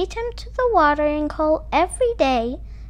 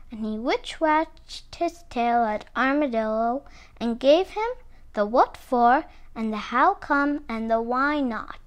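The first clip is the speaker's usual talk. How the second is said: ordinary delivery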